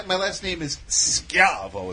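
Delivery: "Schiavo" said Italian-style with a hard sk; proper pronunciation. Shown as a demonstration. Explaining his own surname to friends, proper pronunciation. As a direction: slowly give "Schiavo" its skyah-vo sound. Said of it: "if you pronounce it the proper way".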